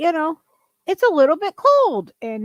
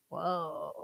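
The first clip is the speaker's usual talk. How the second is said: breathy hushed whisper of amazement; whispered whoa; 10 semitones lower, 15 dB quieter than usual. Retold or imitated. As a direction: whisper it in awe. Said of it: "I was like".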